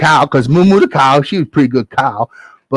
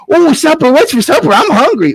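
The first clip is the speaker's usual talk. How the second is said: put-on high, whiny boy asking; hungry boy Jack; 13 semitones higher than usual. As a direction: beg for supper like a small boy. Jack asks about food.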